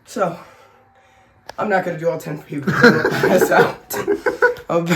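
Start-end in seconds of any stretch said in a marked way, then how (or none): 4.0-4.6 high laugh. Real, not acted. Laughs at passing out joke.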